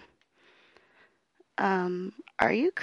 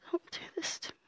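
much softer, unvoiced plea; terrified whisper; 3 semitones higher, 11 dB quieter, much faster than usual. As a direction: whisper it, barely daring.

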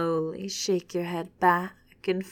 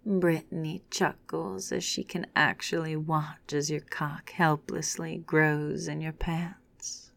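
ordinary delivery, nothing marked

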